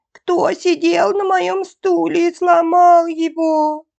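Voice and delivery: high, loud, drawn-out little-bear squeal; squeaky cub voice. A narrator's reading. Bear cub sees his broken chair, high squeak. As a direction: pipe it slowly, way up high. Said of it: "Мишутка ... пропищал".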